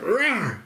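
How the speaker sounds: high-pitched mock bear roar; little boy's high "rawr". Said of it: "keeps doing it".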